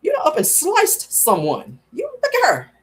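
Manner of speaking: mock scolding. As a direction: laugh at her disbelievingly. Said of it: "I'm like".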